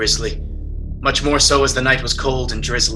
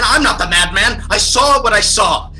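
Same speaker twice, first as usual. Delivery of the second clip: angry protest; 6 semitones higher, 4 dB louder than usual. Acted. Accused man protesting his sanity.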